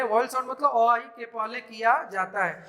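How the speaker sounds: ordinary talk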